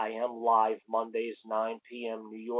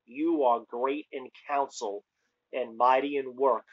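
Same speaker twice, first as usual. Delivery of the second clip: slower reading. Shown as a demonstration. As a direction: read slowly, each phrase set apart. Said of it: "I'll read it a little slower".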